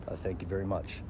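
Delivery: a hint of a low, soft [name] mumble, lightly done; lightly voiced [name] thank-you. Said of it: "just like [name] used to do".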